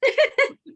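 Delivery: sudden high giggling laugh; high burst of laughter. Real, not acted. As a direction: let out a bright laugh. Laughing at the joke.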